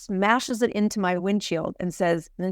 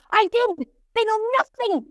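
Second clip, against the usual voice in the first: put-on Brazilian accent. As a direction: snap back as the Brazilian lady. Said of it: "Brazilian woman with this really thick accent".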